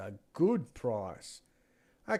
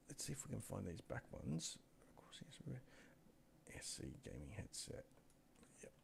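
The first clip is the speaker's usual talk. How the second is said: whispering to himself while he searches; breathy aside under his breath; 16 dB quieter than usual. Real, not acted. Searching his screen, whispering to himself, under his breath.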